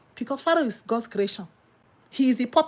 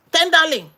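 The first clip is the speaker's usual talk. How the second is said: Tenderling said higher and louder, falling; mock emphatic tenderling; 5 semitones higher, 5 dB louder than usual. Shown as a demonstration. Preacher mocking a man chasing a young bride, emphatic. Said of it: "You're looking for tenderling".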